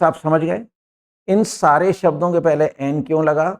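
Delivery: ordinary talk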